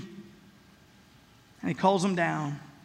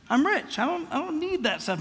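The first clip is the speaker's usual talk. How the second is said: smug rich man; 6 semitones higher, 3 dB quieter than usual. Pretend. Smug rich person voiced.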